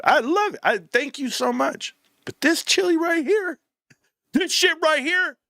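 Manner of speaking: high, sugary voice sweet-talking mama; sweet-talking mama in a squeaky high voice. Retold or imitated. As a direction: coax her in a high, sweet voice. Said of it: "And I was like".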